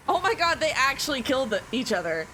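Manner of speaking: high, loud excited outburst; sudden excited gamer reaction. Real, not acted. Surprised at game event.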